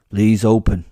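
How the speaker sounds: called out loud to the door; loud, firm order; loud command. Acted. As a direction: project it at the door. Old warrior commands the door.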